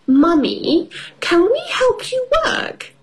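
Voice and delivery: put-on very high little girl voice; child voice. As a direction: ask it like an excited piglet. Peppa voiced in story.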